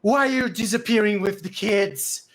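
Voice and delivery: high-pitched furious questioning; very mad. Acted. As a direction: berate them at full voice. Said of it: "And he's very mad".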